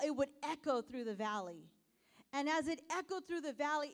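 ordinary delivery

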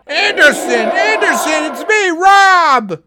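shouting over the crowd; calling out. Real, not acted. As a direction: call out high and loud.

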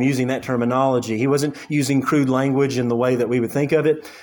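ordinary delivery

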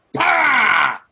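imitating a drunk man's greeting; mock shout. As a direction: bark it out as a playful punch. [name] punches his side.

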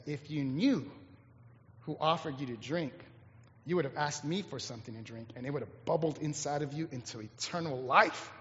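soft, low, quiet reply; gentle Jesus. Acted. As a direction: offer it gently, almost confidentially. Jesus gently answering the woman.

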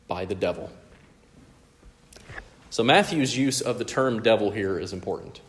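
ordinary talk

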